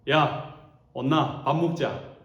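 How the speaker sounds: casual Gyeongsang call, slow on 온나 밥 먹자; plain Gyeongsang-dialect invite to eat. Shown as a demonstration. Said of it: "경상도 버전으로 하면은". (경상도 사투리로 편하게 부르는 말투)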